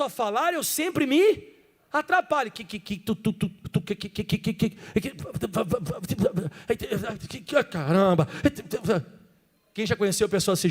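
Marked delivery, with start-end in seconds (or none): 2.5-8.3 mock stuttering on que and tu; stutter demo. Shown as a demonstration. Pastor imitates a stutterer for the church. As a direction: act out a stammer, tripping on each que. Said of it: "Eu sou um cara que vai falar assim".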